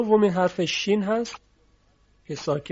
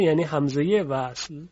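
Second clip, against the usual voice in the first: ordinary talk, nothing marked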